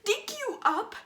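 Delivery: screamed, cracking rage and curses; furious screaming. Acted. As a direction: scream at him through tears. Monologue rage at dying father.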